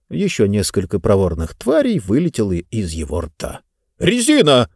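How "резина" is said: wild yell. Acted. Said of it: "вдруг дико завопил он".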